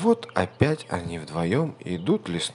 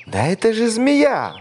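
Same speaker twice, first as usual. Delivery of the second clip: high, loud cry; mouse shouting; 13 semitones higher, 7 dB louder than usual. A narrator's reading. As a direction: call out to spot the snake. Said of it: "мышонок закричал".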